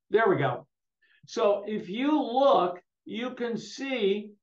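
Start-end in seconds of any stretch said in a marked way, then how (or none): none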